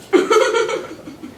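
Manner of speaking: breathless surprised laugh; startled laugh. Real, not acted. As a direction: laugh out in fright. [name] startled by Casey.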